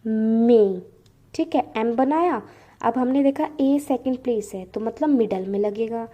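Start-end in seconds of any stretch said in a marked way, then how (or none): 0.1-0.8 मे said loud with its vowel stretched; long drawn vowel. Shown as a demonstration. Teacher demonstrating the long vowel while drawing the outline. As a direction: drag out the ए in मे. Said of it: "कैसे".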